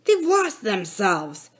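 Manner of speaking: lightly voiced gruff man; slow, mild villain voice; rough villain. A narrator's reading. Jake stopping to listen to shouts, villain voice. Villain Jake's line in story.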